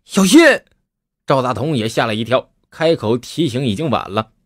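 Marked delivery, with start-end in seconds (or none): 0.0-0.4 warning shout. Acted.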